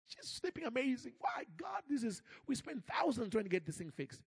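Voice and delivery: high, thrilled praise, rising on Why God; excited testimony. Retold or imitated. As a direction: gush with relief and wonder. Excited father tells the testimony.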